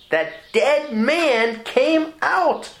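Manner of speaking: preacher's soaring repeat; exultant proclamation. Shown as a demonstration. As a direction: stretch every word in triumph. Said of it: "And that dead man came out".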